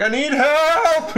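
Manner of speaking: high, slow, laughing mock cry for help; a hammed-up panicked student calling out; mock yell for help. Girl yelling for the librarian, hammed-up high cry. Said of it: "yell for the librarian".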